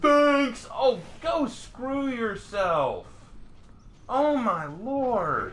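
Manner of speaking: high, drawn-out cries of frustration; real gamer exasperation, stretching the words out; exasperated outburst. Real, not acted. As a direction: curse the game in long, high wails. Exasperated at the game.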